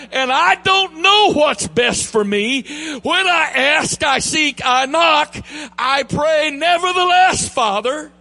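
high, loud, fervent pulpit delivery; rising sermon cadence, shouting the key lines; full-force preaching. Acted. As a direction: preach it out to the congregation with fire. Preaches at full force, raised pitch.

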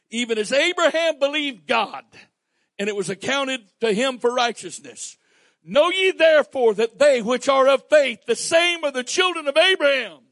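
loud, high, ringing proclamation of the verse; full-force preaching. Acted. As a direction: declare it to the whole church. Preaches the scripture aloud.

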